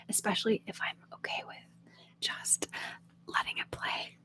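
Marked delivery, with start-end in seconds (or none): none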